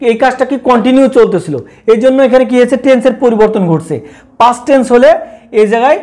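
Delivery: ordinary delivery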